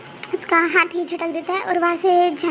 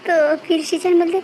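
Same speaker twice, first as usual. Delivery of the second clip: usual speaking voice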